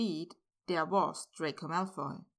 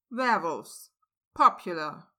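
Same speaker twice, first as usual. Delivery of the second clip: clipped disbelief, each word hit hard; Werewolves? and Popular? snapped back as two sharp questions; sharp questioning; 5 dB louder than usual. A narrator's reading. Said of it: "Remus asked sharply".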